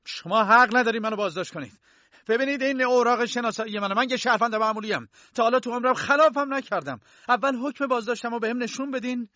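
high-pitched, loud outraged protest; angry shout. Acted. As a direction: shout your indignation at the arresting officers. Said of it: "کی با عصبانیت داد زد".

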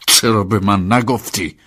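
slow, loud, angry question; angry demand. Acted. Master grabbing his driver by the collar, angry. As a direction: draw out the accusation, loud.

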